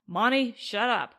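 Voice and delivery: loud sharp shout; yelled. Acted. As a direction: cut him off with a yell. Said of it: "yelled Stuart".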